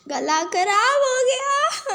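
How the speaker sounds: slow, high and loud tearful wail; real crying over a lost voice. Real, not acted. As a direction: sob it out over a ruined throat. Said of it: "पता नहीं मैं रो क्यों रही हूँ".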